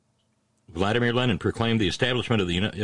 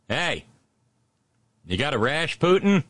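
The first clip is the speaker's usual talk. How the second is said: punchline doctor voice; 7 semitones higher than usual. Retold or imitated. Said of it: "what did the doctor say".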